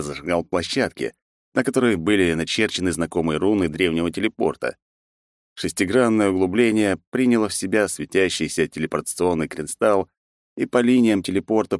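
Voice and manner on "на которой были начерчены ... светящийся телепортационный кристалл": ordinary delivery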